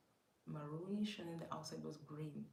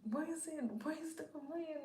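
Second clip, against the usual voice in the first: panicked asking again; 11 semitones higher, 4 dB louder, faster than usual. Retold or imitated. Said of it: "So I'm".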